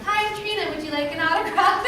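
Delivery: eager celebrity greeting, pitched well up; chirpy greeter. Retold or imitated. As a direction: sell the autograph like a cheery hostess. Perky greeting to fans.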